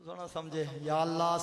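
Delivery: ordinary talk, nothing marked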